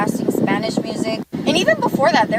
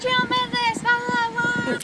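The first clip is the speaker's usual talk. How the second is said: much higher, softer sing-song mockery; mocking the commenters; 11 semitones higher, 5 dB quieter than usual. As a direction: mimic the critics in a high whiny voice. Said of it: "a lot of people are saying".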